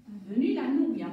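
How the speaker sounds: ordinary talk, nothing marked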